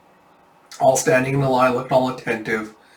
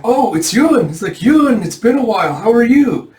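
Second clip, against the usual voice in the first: much higher and louder, delighted to see him; excited greeting; 10 semitones higher, 7 dB louder than usual. Acted. Villagers greeting returning Yun, thrilled and loud. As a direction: call out happily to a friend back home.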